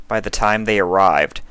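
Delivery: ordinary delivery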